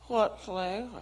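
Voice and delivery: mock garbled counter talk in a put-on voice; one-up the customer with an odd server voice; lightly voiced, mangled server question; imitated city server. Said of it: "But she's city, so she one-ups me".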